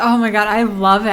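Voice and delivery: normal talking voice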